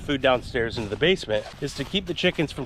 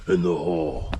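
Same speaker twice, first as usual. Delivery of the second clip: low, mock-dramatic story voice; deepest voice; 8 semitones lower than usual. Mom echoing the family's story title, deep voice. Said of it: "in the deepest voice they know how".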